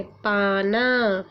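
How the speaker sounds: पाना drawn out very slowly, syllable by syllable; teacher stretching पाना slowly; slow modelled word. Shown as a demonstration. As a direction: stretch पाना out for children to copy.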